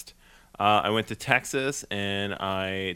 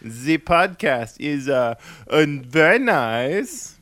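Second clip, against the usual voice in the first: slow, hammed-up French voice praising the show; podcast host joking with his cohost, slow mock French accent; 3 semitones higher, 6 dB louder, much slower than usual. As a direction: play a silly Frenchman, drawn out. Jokey foreign greeting.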